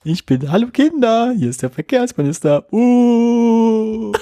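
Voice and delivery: high, goofy puppet-show voice; goofy muffled sock puppet. Pretend. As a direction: greet the kids like a hand puppet. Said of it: "Witzfigur in so einem Kasperle-Theater". (hohe alberne Kasperle-Stimme)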